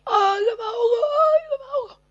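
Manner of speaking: very high wailing cry for help; mock drowning cry. Preacher acting the drowning sinner crying out. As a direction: wail like you're going under.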